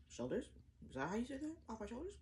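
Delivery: normal talking voice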